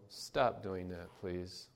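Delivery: put-on soft, slow first request to a child; gently asks the child to stop; mild parental request. Parent to misbehaving child, soft and slow. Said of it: "a parent may say".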